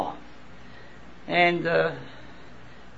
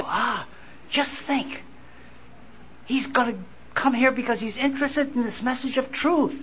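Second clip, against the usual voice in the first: mock awe; 5 semitones higher, 6 dB quieter than usual. Mock awed congregant at celebrity.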